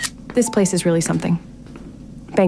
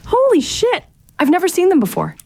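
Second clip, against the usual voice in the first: high-pitched, astonished outburst; awed amazement; 12 semitones higher than usual. Acted. Stargazer suddenly seeing the northern lights, amazed. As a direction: gasp it out in high-pitched wonder.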